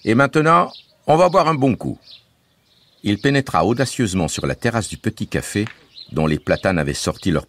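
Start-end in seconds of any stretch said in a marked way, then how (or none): 0.0-2.0 put-on higher, lively voice for the boy Bouzig; Bouzig's voice. A narrator's reading. Bouzig reassuring the family, bright boyish voice. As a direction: play the cheerful young guide Bouzig.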